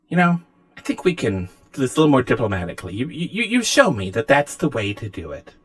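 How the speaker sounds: lightly voiced, hesitant game host; lightly acted, measured concession with pauses; diplomatic AI figure. Game master plays Anna.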